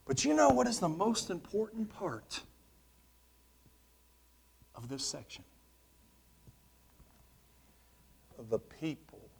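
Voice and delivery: a preacher going hushed, then whispering the key line; hushed reveal. Acted. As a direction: lean in and let it sink to a whisper for the big point. Preacher hushes to reveal his point.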